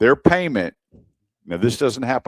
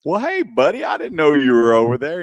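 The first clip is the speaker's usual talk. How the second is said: mock innocent; 6 semitones higher, 3 dB louder than usual. Mock-innocent greeting to dealer.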